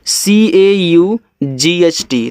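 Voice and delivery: letter by letter, drawn out; spelling aloud for students; slow spelling out. Shown as a demonstration. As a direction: spell each letter slowly. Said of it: "इसको पढ़ते हैं काट".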